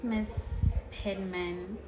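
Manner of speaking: ordinary delivery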